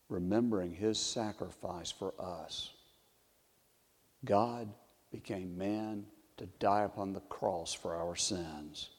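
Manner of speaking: soft, low, solemn reflection; hushed solemnity. Real, not acted. Solemn communion reflection on the cross.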